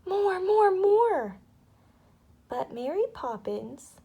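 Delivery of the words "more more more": put-on much higher, louder, far child voice; eager begging child. A narrator's reading. Michael begging Mary Poppins for more, high and loud. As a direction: beg greedily like a small boy.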